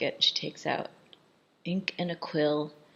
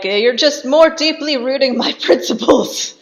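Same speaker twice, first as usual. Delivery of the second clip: high, loud retort breaking into a laugh; mock defiant yelling; 9 semitones higher, 13 dB louder than usual. Marquis defying his torturers, hammed-up yell. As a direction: imitate yelled defiance, amused. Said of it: "he's just yelling obscenities".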